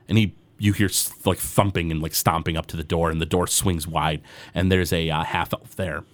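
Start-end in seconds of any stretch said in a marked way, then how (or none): none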